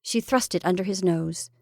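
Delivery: ordinary delivery, nothing marked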